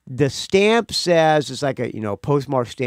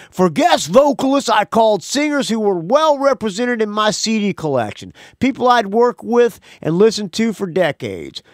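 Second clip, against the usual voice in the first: Bunny voice; 6 semitones higher, 3 dB louder than usual. Shown as a demonstration. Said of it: "doing the Bunny voice".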